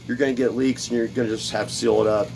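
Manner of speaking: ordinary delivery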